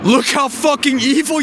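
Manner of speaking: ordinary talk, nothing marked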